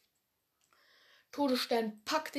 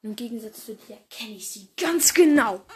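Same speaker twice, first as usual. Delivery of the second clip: triumphant boast; 8 dB louder than usual. Acted. Villain boasting at enemy.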